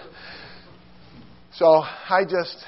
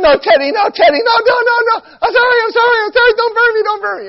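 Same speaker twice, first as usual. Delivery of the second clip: high, loud panicked begging; terrified pleading; 9 dB louder, faster than usual. Retold or imitated. Kid begging his brother Teddy not to burn him. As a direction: beg for mercy in a shriek.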